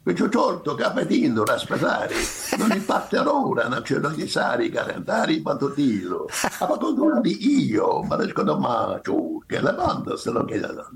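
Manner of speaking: rapid, high mock Italian gibberish; hammed-up fake Italian patter. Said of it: "You wanna hear my fake Italian?".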